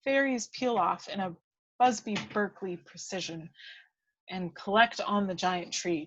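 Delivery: usual speaking voice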